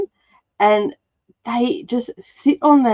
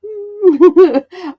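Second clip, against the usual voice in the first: loud high giggle; high burst of laughter; 11 semitones higher, 5 dB louder than usual. Real, not acted.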